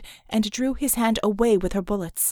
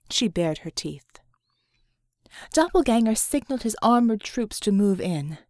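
ordinary delivery

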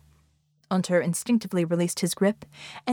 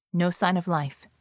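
usual speaking voice

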